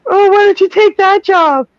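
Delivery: high mock wife voice; whiny, high-pitched imitation of a wife; nagging wife. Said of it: "all women say".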